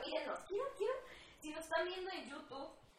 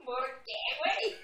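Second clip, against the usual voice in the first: louder, higher mock outrage; mock outraged why; 9 semitones higher, 9 dB louder, slower than usual. Retelling her answer to her friend, mock outrage. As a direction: throw it back in disbelief. Retold disbelieving reaction.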